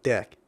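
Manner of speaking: "deck" with a short open e, falling; "deck" said flat and falling, not dick; saying deck. Shown as a demonstration. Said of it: "Just like that".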